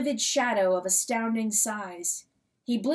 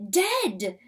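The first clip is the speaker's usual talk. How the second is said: plain reading voice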